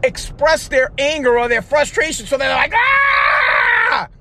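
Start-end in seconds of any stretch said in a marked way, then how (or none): 2.8-4.1 high, loud wordless "Ah!" tantrum cry; toddler tantrum yell. Retold or imitated. Imitating a two-year-old who can't voice anger, high tantrum yell. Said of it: "like a two-year-old that doesn't know how to express their anger".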